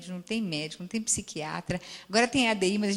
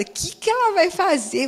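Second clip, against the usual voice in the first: alarmed question, higher and louder; anxious, half to herself; worried; 9 semitones higher, 7 dB louder than usual. Retold or imitated. Therapist recalls fearing the patient's plan, alarmed.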